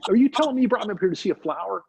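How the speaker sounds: high, fast acted indignation; incredulous outrage. Dan to his teacher at the mountaintop, incredulous. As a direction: rush it out in mock outrage. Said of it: "He's all pissed off".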